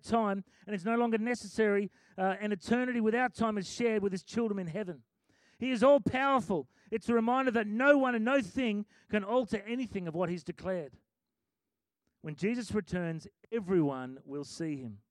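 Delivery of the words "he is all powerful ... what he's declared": raised, ringing preacher's voice; preaching. Acted. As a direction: preach it with rising force. Preaching builds.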